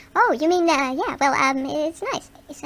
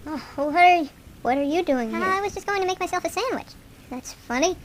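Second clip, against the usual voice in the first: usual speaking voice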